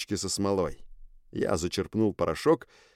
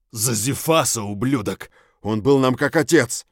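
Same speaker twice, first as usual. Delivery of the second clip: angry creature cry; 3 semitones higher, 5 dB louder than usual. Acted. Gorgun beats the hero, grieving.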